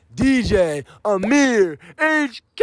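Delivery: slow, drawn-out hype introduction; hype announcer. Acted. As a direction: call the DJ out like a club MC. Host announces the DJ.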